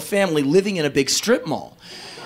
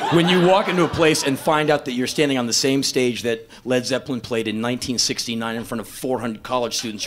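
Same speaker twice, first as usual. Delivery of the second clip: normal talking voice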